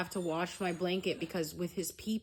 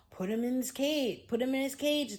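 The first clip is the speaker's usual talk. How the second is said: high-pitched, exasperated mimicry; mocking others' advice; 8 semitones higher, 4 dB louder than usual. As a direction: mimic their pushy advice in a higher voice.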